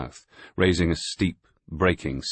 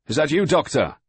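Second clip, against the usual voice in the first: loud call across a storm; shouting over the wind; 11 semitones higher, 5 dB louder than usual. A narrator's reading. Sailing master calling to the doctor through the storm, shouting. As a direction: shout it so he's heard over the gale.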